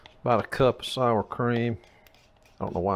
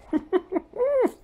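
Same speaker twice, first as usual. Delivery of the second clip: squealing high-pitched giggle; high laugh. Real, not acted. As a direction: let out a high delighted laugh. Delighted by the tasted food.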